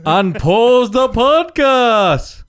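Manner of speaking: high, loud, slow announcer call. Acted. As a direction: boom it out like a show host. Announces podcast restart.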